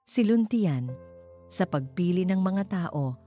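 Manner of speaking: plain reading voice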